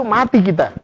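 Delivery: ordinary talk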